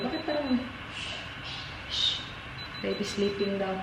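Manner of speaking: hushed. Real, not acted. Baby asleep, hushing the camera. (mahinang boses, tulog si baby)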